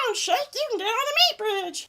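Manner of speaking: high, squeezed cartoon character voice; very high-pitched silly impression; Meatwad. Shown as a demonstration. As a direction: squeak it out as a goofy cartoon creature. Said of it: "and he talks like this".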